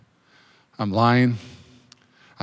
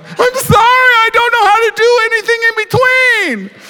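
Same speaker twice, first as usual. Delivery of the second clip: squeaky yelled apology; 5 dB louder than usual. Retold or imitated. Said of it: "I was like".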